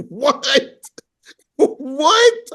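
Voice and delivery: high, loud incredulous what; hearing Mike insult a beggar, incredulous and loud; shocked disbelief. Retold or imitated. Speaker's own shocked reaction.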